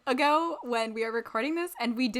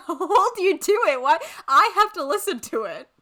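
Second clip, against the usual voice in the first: laughing protest; 7 semitones higher, 8 dB louder than usual. Real, not acted. Laughing protest at friend's awful accent.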